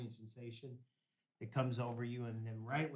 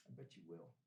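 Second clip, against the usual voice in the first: cold quiet dare; 7 semitones lower, 12 dB quieter than usual. Retold or imitated. Cold dare to his father.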